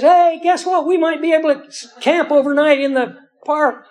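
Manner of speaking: put-on high, excited little girl calling out; excited child yelling. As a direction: call it out like a thrilled five-year-old. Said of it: "runs off yelling to the other kids".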